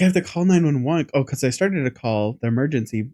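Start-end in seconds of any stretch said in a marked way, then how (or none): 0.0-1.1 higher and louder, urgently retold panic; urgent alarm. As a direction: relive the moment of realizing it's serious. Bundle on fire, must call.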